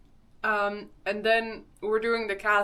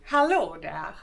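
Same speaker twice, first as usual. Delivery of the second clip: lightly put-on Skåne accent greeting; mock Skåne-style hello; 3 semitones lower, 4 dB louder than usual. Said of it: "I'm so bad at this accent".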